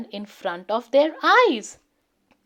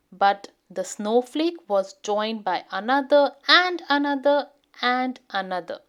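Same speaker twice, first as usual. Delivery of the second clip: usual speaking voice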